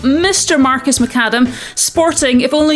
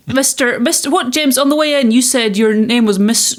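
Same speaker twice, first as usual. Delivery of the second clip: ordinary delivery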